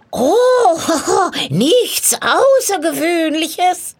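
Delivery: high, loud cackling laugh into the words; witch's cackle. A narrator's reading. Witch brushing off the soldier's question, cackling. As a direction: cackle slyly like a hag. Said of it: "fuhr die Hexe fort".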